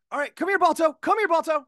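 high, bright call to a dog; sing-song call for the dog. Acted. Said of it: "the line coming in for you is gonna be".

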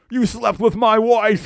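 slow, loud enraged yell; enraged scream. Acted. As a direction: scream it in a rage. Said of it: "he screams".

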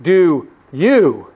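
"you" set apart and swooping in pitch; stress on you. Shown as a demonstration. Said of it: "with the emphatic "you".

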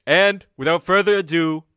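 projected stage introduction; announcer. Acted. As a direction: build up the next speaker. Host introduces next speaker.

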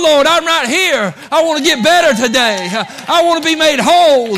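high, loud pleading prayer; impassioned prayer. Acted. Preacher voicing a desperate prayer for healing, shouted. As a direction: cry out to God with everything.

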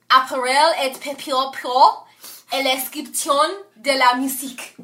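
butcher the French title on purpose with a mock French accent; bad French accent. Shown as a demonstration. Host attempting the patent title in a clumsy French accent. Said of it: "attempt to fuck up this name in French".

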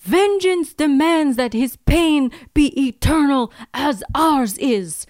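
a hammed-up vengeful villain saying vun; Russian accent. The villain demands eternal pain, a hammed-up Russian accent. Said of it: "Why is it "vun"?".

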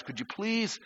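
a gently exasperated God; God's patient plea. Retold or imitated. God asks the fed-up believer to deal with the button, pleading. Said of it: "And God goes".

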